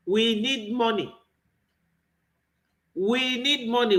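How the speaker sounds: ordinary talk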